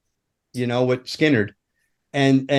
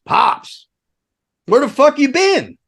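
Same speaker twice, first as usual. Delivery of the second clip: half-shouted mock exasperation; exasperated call; 10 semitones higher than usual. Rigger greeting the missing roadie, exasperated.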